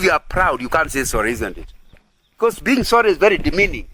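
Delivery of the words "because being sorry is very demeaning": ordinary talk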